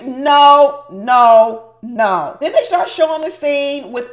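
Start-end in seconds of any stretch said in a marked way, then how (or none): 0.0-2.4 loud no. Real, not acted. Loud refusal, disgusted.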